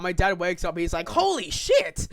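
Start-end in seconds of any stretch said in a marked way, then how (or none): 1.1-2.1 loud, high mock shock; shocked exclamation. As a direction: imitate a dad jolted awake and shouting. Dad's shocked reaction acted in story.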